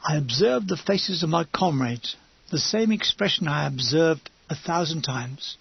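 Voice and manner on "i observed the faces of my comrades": ordinary delivery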